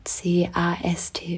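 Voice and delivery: whispered letter by letter spelling. Shown as a demonstration. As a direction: whisper each letter separately.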